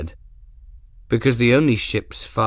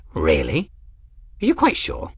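put-on surprised old man; 2 semitones lower than usual. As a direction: squeak out disbelief like an old eccentric. Eccentric old man Ferris.

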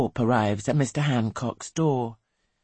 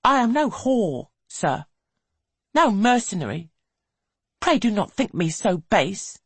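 icily proud, high voice; cold; 8 semitones higher, 4 dB louder than usual. A narrator's reading. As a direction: rebuff him coldly. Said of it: "says coldly".

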